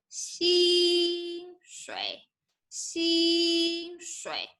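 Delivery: 薪水 said with both syllables high and level, first tone; Mandarin 薪水. Shown as a demonstration. 老师示范薪水，两个第一声. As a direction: hold 薪水 high and even on each syllable. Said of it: "So 薪水".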